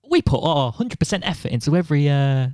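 high, bright corporate promo voice; chirpy fake brand voice; mock corporate voice. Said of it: "rubbish packaging where they go".

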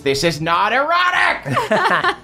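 very high, drawn-out mock scream; hammed-up indignant shriek; character scream. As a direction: scream it in protest, stretched out. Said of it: "Saul screams".

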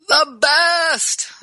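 high, emphatic gush of enthusiasm; excited superlative, high and drawn out. Real, not acted. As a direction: burst with excitement, pitched right up. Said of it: "I get so excited".

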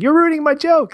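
very high, loud mock complaint; Mouth protesting. Mouth answering Mikey about his joke, in squeaky protest. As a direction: squeal back in imitated indignation. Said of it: "And he's like".

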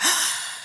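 sharp soft intake of breath in awe; awed gasp. Acted. Describing a feeling of wonder, awed gasp. Showing the energy she feels inside.

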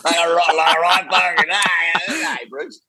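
put-on playful Australian accent teasing the host. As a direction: ham up an Aussie bogan. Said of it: "You do the accent well".

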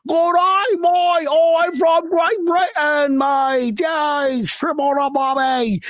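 put-on high, hammy Aussie accent; badly faked British sliding into broad Australian, drawn out; botched British accent, really Australian. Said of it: "That was Australian".

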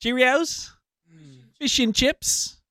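high, put-on British accent on request; British accent again. Host redoes his British accent when a co-host asks. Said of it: "One more time".

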